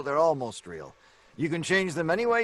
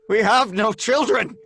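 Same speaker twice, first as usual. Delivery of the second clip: louder, higher insistent denial; insisting outburst; 6 semitones higher, 6 dB louder than usual. Acted. Patient to doctor, loud frantic denial. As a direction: burst out insisting.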